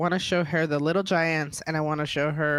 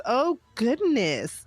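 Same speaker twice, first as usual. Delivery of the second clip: high, drawn-out mock exclamation; imitated mom; 8 semitones higher, much slower than usual. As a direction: stretch it out, imitating a mom's mild oath. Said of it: "I heard your mom say it".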